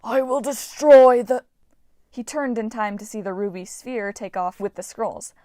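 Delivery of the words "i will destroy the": loud, slow, otherworldly threat cut off; menacing armored brute. Acted. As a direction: spit each word like a machine warrior. Armored Eidolon threatening prisoners.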